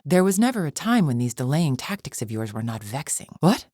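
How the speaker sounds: usual speaking voice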